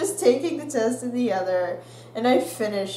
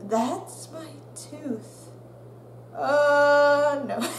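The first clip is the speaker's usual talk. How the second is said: dawning dismay; 4 semitones higher, much slower than usual. Retold or imitated. Student realizing the tooth fell out, stunned. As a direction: let it sink in slowly. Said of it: "takes me a second to be like".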